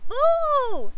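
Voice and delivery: high, loud ghostly boo; yell. A narrator's reading. Young ghost copying the principal, high yell. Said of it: "Annabelle yelled".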